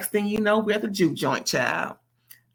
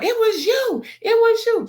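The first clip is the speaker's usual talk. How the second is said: much higher, louder mock scream of accusation; imitating a scream; 5 dB louder than usual. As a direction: shriek the accusation like she's losing it. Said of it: "she just started screaming".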